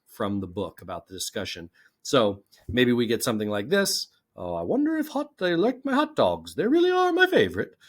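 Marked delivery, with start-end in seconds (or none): 4.3-7.8 high-pitched put-on British accent, rising to squeaky; a playful, hammed-up British lady's voice. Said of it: "That was my best British accent".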